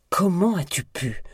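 high-pitched, reproachful cry; pained reproach. A narrator's reading. Betrayed sister confronts Miranda, high pained reproach. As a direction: demand an answer, wounded.

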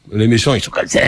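lightly hammed-up villain voice; caricature villain. Actor mocking colleagues who overplay bad guys, caricature villain. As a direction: slide into a mock stock-villain voice. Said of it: "en faisant un méchant".

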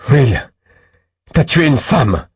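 a loud, high, horrified accusation; shocked shout. Acted. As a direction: throw the accusation at him. Confronting the killer, shouting.

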